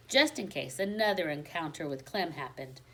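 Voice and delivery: usual speaking voice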